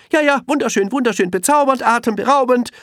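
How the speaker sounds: very high, loud, rattled-off praise; dismissive monkeys. A narrator's reading. Monkeys swing past Elmar, very high rattled-off praise. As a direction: gush without looking. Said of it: "erwiderten die Äffchen".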